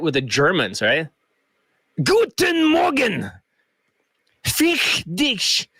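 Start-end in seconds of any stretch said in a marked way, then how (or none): 2.0-3.3 high, slow, shouted mock German greeting; German mode. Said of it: "got into my German mode". 4.4-5.7 high mock German snap; German mode. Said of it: "got into my German mode".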